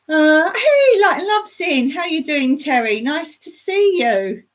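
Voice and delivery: light up as a friend arrives; excited greeting. Real, not acted.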